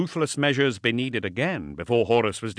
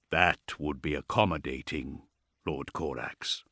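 very low, softer machine voice; bass dreadnought; 8 semitones lower, 6 dB quieter than usual. A narrator's reading. Dreadnought accepts the Primarch's invitation, deep and grave. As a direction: drop deep and reply with formal gravity. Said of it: "its bass voice".

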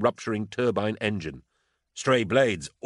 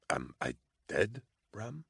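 faint wet mumble, much softer; dying mumble; 11 dB quieter than usual. A narrator's reading. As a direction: barely get it out, dying. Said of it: "he mumbled".

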